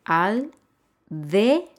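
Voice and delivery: "al, de" as separate syllables. Shown as a demonstration. Teacher splitting aldea for learners, "al, de" syllable by syllable. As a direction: pause firmly between AL and DE. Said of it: "Si yo separo la palabra aldea en sílabas".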